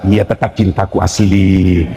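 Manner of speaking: low, slow, drawn-out sweet-talk; mock tender reassurance. Husband soothes wife about fake gold, mock tender low drawl. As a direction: croon it low like a coaxing husband.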